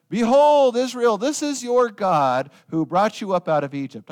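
loud, high royal announcement; booming Behold, then proclaiming; grand proclamation. Retold or imitated. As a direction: proclaim it like a king to the crowds. King proclaims the calf as god.

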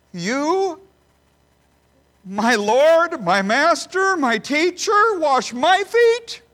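rising cry of disbelief, climbing to the last words; incredulous outcry. Acted. Peter to his Lord, pitch and volume building in disbelief.

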